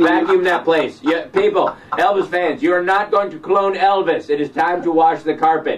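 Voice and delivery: pleading announcement, half laughing; mock exasperated plea. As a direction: lecture the fans in mock outrage. Mock rant addressed to [name] fans.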